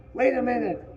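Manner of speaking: put-on high boyish call, falling at the end; fat boy calling. A narrator's reading. Fat boy calls from the undergrowth, high boyish voice.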